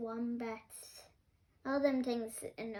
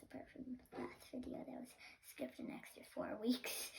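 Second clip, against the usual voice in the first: whispered aside; whispering; 12 dB quieter, faster than usual. Real, not acted. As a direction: drop to a sheepish whisper. Quiet aside about skipped weeks.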